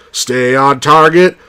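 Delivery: slow commands then high panicked yells; pilot in battle. Retold or imitated. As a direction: play the X-wing pilots under fire. Acting the trench run.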